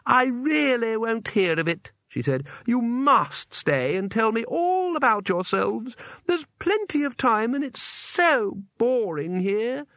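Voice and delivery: a deranged girl pretending politeness; sweetly menacing girl. A narrator's reading. Isabel stopping the two visitors, high, slow and sugary.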